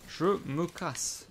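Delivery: very slow, stretched out; drawn out. Real, not acted. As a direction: drag each word out lazily. Répète la même phrase, traînée. (très lent, étiré)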